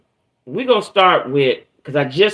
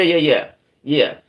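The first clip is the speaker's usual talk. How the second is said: usual speaking voice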